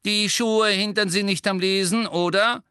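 high, loud, irritated receptionist; snippy rebuke at full volume; grumpy. A narrator's reading. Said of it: "deutete missgelaunt". (missgelaunt und laut)

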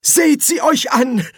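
high, drawn-out exclamation of dismay; a loud, lamenting sigh. A narrator's reading. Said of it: "seufzte Treibe mich selbst".

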